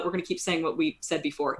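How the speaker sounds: usual speaking voice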